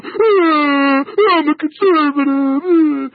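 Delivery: sneering whimpering imitation of a caller; mocking whiny caller. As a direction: mimic the caller as a crybaby. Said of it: "these guys are calling in going".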